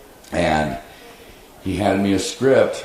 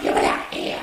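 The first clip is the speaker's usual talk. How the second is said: creature voice. Retold or imitated. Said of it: "So I went".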